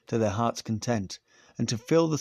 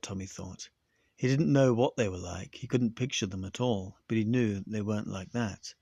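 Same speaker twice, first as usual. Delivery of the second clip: ordinary delivery, nothing marked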